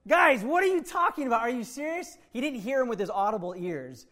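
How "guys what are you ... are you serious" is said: high, loud disbelieving Jesus; Jesus exasperated with his disciples, loud and high. Acted. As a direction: throw up your hands in disbelief. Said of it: "the [name] version".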